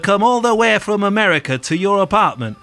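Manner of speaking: outraged Manc rant, playing [name]; Manc accent. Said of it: "living out my favourite Manc accent".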